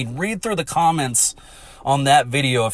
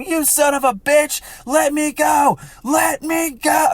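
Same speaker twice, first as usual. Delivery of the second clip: imitated angry yelling; 14 semitones higher than usual. Dragged player yelling at the troll, imitated screaming. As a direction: holler like she's being dragged again. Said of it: "yelling at him".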